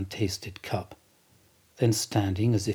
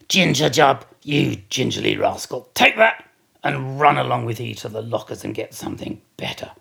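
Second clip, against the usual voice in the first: scolding; 2 semitones higher, 6 dB louder than usual. Acted. Stubb scolds steward.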